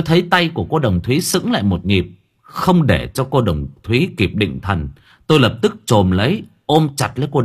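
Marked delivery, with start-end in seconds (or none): none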